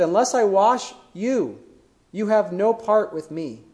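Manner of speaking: slow, weighty delivery with I stressed; slow and weighty, I and no leaned on; solemn, slow. Retold or imitated. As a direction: let each word of Jesus land slowly. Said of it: "Jesus' response is so powerful".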